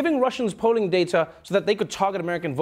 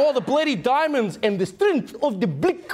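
higher, hammed-up bad African accent; bad movie accents; 5 semitones higher than usual. DiCaprio and Whitaker's character doing bad African accents together. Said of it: "bad accents together".

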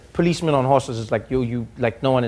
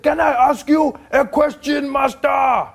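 much higher, loud, slow mock deference through laughter; mock humble grandfather; 11 semitones higher, 4 dB louder, slower than usual. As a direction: play the cheeky polite old man, drawn out. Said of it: "He said".

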